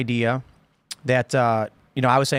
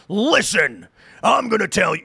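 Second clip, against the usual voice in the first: commanding guy; 5 semitones higher than usual. Retold or imitated. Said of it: "a guy who says".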